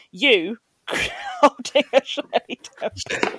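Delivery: slow, high, laughing through the words; real laughter breaking up the sentence. Real, not acted. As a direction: crack up while picturing it. Cracking up at imagined photo.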